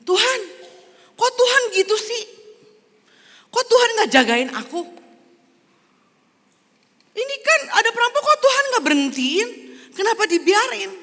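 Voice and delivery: very high, loud, whiny protest; John's indignant complaint. Acted. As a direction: demand answers from God after the robbery. Robbed John complains to God.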